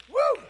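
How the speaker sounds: short, high-pitched "woo"; preacher's exultant whoop. Real, not acted. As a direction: let out a quick high whoop. Preacher whoops after his climax.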